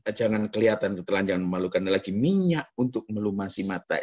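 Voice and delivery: normal talking voice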